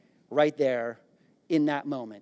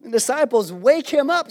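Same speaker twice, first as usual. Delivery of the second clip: normal talking voice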